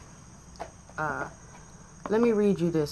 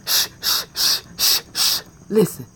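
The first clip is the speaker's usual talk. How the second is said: mock shushing whisper; 2 semitones higher, 5 dB louder than usual. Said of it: "Be like".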